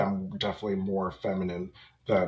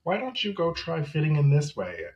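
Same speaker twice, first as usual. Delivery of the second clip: preachy teacher; 7 semitones higher than usual. Retold or imitated. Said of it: "coming up to you being like".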